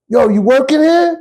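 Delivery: high, casual street-guy "Yo"; Ricky's street voice. Retold or imitated. Ricky sizing up the new rookie, casual and high. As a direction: toss it off like a smooth street dude.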